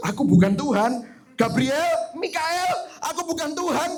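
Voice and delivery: high, rushed mock panic; Tuhan panik diperankan. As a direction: play God flustered and insecure. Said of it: "katanya Pastor Mike". (Tuhan yang panik, nada tinggi berlebihan)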